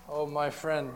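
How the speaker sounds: ordinary delivery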